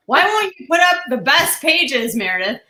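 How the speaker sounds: loud, high mock yell; Dave yelling. Dave to Meredith about her choice of pages, mock yelling. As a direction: yell it like an exasperated complaint, retold. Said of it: "He's yelling at me".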